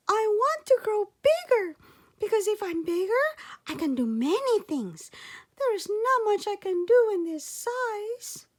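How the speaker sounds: put-on high, loud eager little boy voice; a tiny boy pleading to help, pitched high and loud; little boy Sandangao. Child hero voiced in story.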